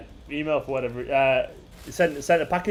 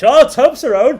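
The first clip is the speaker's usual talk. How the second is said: high, loud delighted cry of a name; excited call; 9 semitones higher, 11 dB louder, slower than usual. Real, not acted. Streamer spotting the winning chat name, excited shout.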